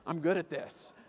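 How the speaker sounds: low, smug trash talk; cocky line racer. Acted. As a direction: gloat quietly to yourself. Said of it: "and you're like".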